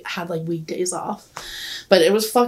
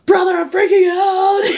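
high, mock-tearful wail; retold panicked cry; 10 semitones higher, 4 dB louder than usual. Sister calling brother while too high, high panicked whine. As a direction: act out a panicked plea for rescue.

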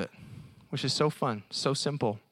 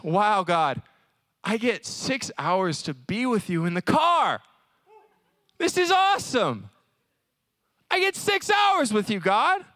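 thrilled, much higher and louder; excited thanks; 6 semitones higher, 3 dB louder than usual. Pretend. Traveler thanking God for the car ride, thrilled and loud. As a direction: gush with joy at time with God. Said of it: "the Thanksgiving route".

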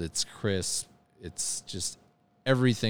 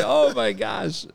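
amazed; 7 dB louder, slower than usual. Retold or imitated. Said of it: "I was like".

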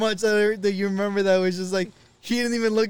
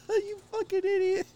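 high, squeaky words through laughs; helpless laughter. Real, not acted. Cracking up at the retold crash.

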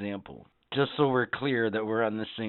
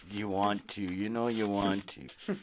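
Sith Lord voice; 4 semitones lower, 10 dB quieter than usual. Retold or imitated. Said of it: "like it's a Sith Lord".

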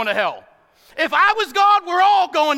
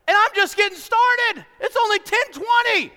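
high, loud pumped-up cheer; excited whoop; 9 semitones higher, 5 dB louder than usual. Real, not acted. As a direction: fire up the room with a whoop. Preacher pumped, cheering himself.

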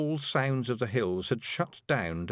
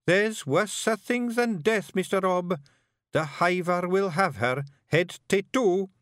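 high Scots laddie giving dire warning; high Scottish character voice, foreboding; grave young Feegle bard; 8 semitones higher, 3 dB louder than usual. Acted. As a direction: warn the chief with slow, dread-filled emphasis. Billy warns Rob.

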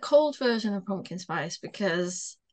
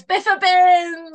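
loud, slow mock Welsh accent, higher; bad Welsh accent; 8 semitones higher, 11 dB louder, much slower than usual. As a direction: ham up a bad Welsh accent on Biffa bins. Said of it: "That's a shit Welsh accent".

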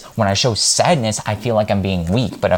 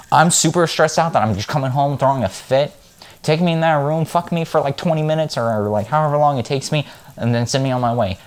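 mock pleading; 6 semitones higher than usual. Voicing men's needs.

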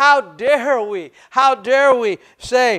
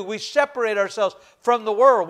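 ordinary delivery

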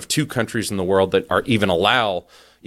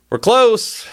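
much higher and louder "We're close"; alarmed repeat; 12 semitones higher, 5 dB louder than usual. Real, not acted. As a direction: hit "close" with real alarm. Repeated warning, sharply raised.

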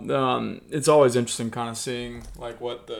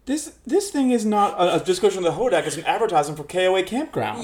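much higher and louder, laughing disbelief; exasperated outburst; 10 semitones higher, 9 dB louder than usual. Real, not acted. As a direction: squeal in disbelief.

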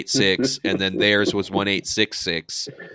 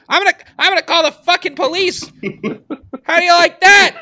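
furious shout; 4 dB louder, faster than usual. Retold or imitated. Guy on the phone angry at prank caller.

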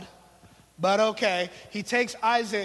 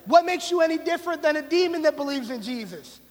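a hint of casual, higher street talk; James in street slang; 7 semitones higher, 5 dB louder than usual. Preacher voicing James as a blunt friend, higher and louder. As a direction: paraphrase James like a buddy calling you out. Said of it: "He's saying".

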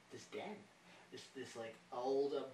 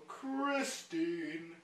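mock stage call; 2 semitones higher, much slower than usual. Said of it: "And they go".

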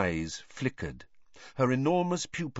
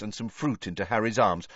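ordinary delivery, nothing marked

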